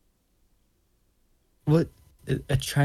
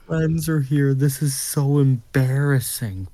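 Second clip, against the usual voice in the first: put-on higher, louder embarrassed teen; mock embarrassed daughter; 4 semitones higher, 4 dB louder than usual. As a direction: groan like a mortified daughter.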